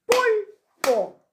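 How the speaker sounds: Pulpo split, PUL high and loud; stress on first syllable. Shown as a demonstration. Teacher showing the tonic syllable, Pulpo with a loud, high PUL. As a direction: hit PUL hard in Pulpo, then drop on PO. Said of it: "tenía mayor fuerza de voz".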